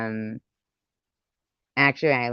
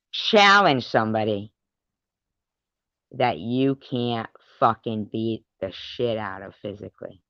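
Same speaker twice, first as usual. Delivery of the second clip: ordinary delivery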